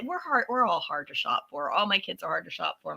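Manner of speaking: ordinary delivery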